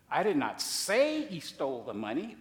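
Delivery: "say" stressed with a rise. Shown as a demonstration. Preacher showing how the phrase implies something, stress on SAY. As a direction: lean hard on the word "say". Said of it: "Well, did you imply it?".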